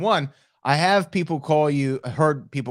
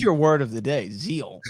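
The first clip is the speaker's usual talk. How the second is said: ordinary talk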